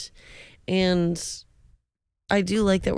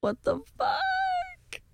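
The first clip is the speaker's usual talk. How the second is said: shocked; 6 dB quieter, much slower than usual. Real, not acted. Shocked reaction, huge pitch jump.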